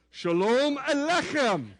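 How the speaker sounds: slow, careful Shalom Aleichem with a throaty ch; teaching the congregation "Shalom Aleichem" with slow Hebrew sounds; Hebrew greeting demo. Shown as a demonstration. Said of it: "Repeat after me".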